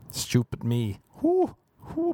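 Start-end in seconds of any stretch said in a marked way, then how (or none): 1.2-2.1 high squealed whoo; mock whoo. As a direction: yelp it up high. Mock exclamation.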